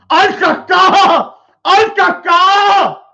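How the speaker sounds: loud, slow drawn-out shout of the name; calling out a name. Pretend. Shouting a name to a boy outside, calling him loud and slow. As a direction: call him across the yard, slow and loud.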